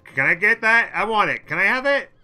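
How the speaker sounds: high, loud whiny pleading; mock begging AI civ. Mimicking AI civilizations nagging for a great work, whiny and loud. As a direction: ham up a greedy nation nagging for your art. Voicing AI civs begging for works.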